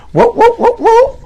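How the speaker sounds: very high squealed whoas; shocked whoa. Real, not acted. Host shocked that she turns into a dog, squealing. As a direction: shriek it out in shock. Reacting to woman turning dog.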